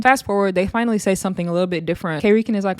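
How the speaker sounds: ordinary delivery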